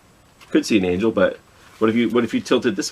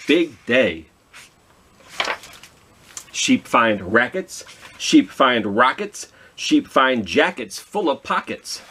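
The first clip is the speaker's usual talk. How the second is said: slow, rhythmic picture-book reading; slow rhythmic storybook reading to kids; reading to children; 4 semitones higher, much slower than usual. Acted. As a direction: read the rhyme out slowly with a bounce. Reads rhyming picture book.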